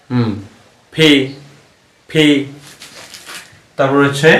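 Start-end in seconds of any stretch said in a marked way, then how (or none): 0.9-2.2 "ফি" as English P, lips together, then a puff; correct pronunciation. Shown as a demonstration. Teacher models the letter P for learners, corrected pronunciation. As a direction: burst "ফি" from both lips with aspiration. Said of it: "দুই ঠোঁট একসাথে লাগে".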